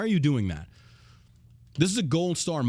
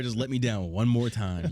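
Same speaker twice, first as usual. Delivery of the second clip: normal talking voice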